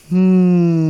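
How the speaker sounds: long, loud, high hum; pondering hum. Acted. Puppet ponders the question.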